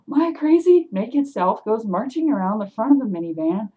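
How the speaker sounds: plain reading voice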